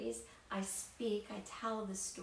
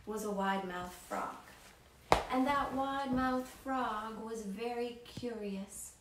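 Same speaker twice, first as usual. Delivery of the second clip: story narration. A narrator's reading. Storyteller reading to children in an animated voice. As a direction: tell it to wide-eyed kids.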